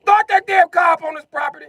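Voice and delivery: high, loud shouted warning; grandma shouting. Retold or imitated. Grandma shouting at someone starting the car, high loud shout. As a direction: yell like a frantic old lady.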